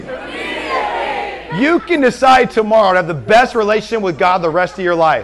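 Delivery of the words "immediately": squeaky mock echo. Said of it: "Everyone say immediately".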